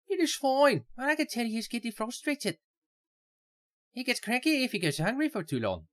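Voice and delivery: put-on Irish accent. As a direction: give her a high Irish lilt. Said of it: "Irish accent".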